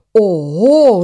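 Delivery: loud, high surprised oho. Acted. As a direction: shout the oho as you catch on. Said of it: "riep de egel". (de egel roept verbaasd en luid oho)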